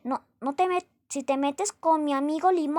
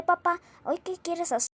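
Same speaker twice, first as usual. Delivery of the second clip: normal talking voice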